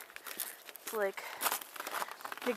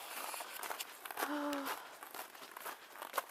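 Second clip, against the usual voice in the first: sigh; 5 semitones higher, 8 dB quieter than usual. Real, not acted. Reluctant about walking to school.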